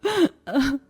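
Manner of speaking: a sharp sob of pain; pained crying. Acted. Pregnant wife crying out in labour pain. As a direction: cry out as the labour pain hits.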